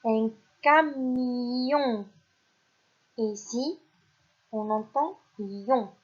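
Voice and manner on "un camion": "un camion" very slow, syllables pulled apart; slow dictation. Shown as a demonstration. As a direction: draw out "un camion" for the class. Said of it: "Et là".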